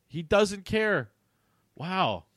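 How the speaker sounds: high, slow disbelief; stunned at the callousness; incredulous. Real, not acted. Reacting to character.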